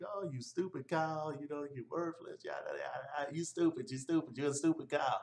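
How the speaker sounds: high-pitched mock rant at normal volume; snapping insult. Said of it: "So he snapped, you know, he was like".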